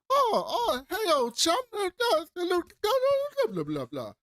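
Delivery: put-on very high, plummy gibberish of a jolly old chum; posh old British toff. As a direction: squeak out cheery upper-class nonsense. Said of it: "I don't know what the fuck he was saying".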